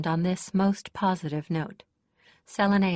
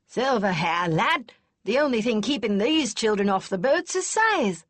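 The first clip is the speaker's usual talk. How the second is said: put-on louder, gruff old lady scoffing kindly; snorting, not unkind; 5 semitones higher, 5 dB louder than usual. A narrator's reading. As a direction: snort at the lad but keep it kind. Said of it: "Kester snorted, though not unkindly".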